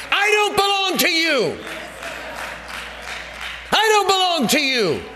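high shouted plea; wages crying out. Acted. Preacher voicing the wages, high shout.

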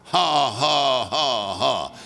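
spaced ha-has stepping down low; forced laugh. Retold or imitated. Preacher showing forty-five minutes of forced laughing. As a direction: imitate a laboured, made-up laugh. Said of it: "forced myself to laugh".

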